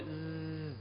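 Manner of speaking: sustained zzz like a bee; imitated bee humming on zzz; bee buzz. Shown as a demonstration. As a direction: buzz a long, soft, low Zay. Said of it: "تنطق صوت الزاي".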